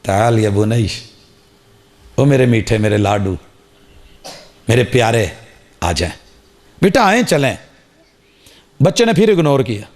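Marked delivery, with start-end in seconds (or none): 0.0-7.6 lightly voiced father sweet-talking his son; tender coaxing. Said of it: "باپ نے دوبارہ کہا".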